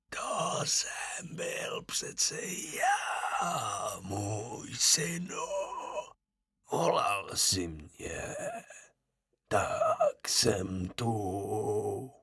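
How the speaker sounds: slow, soft, breathy whisper from the grave; a dead man calling his son, slow and eerie; ghost of the dead father. A narrator's reading. (pomalý šeptavý hlas ducha mrtvého otce)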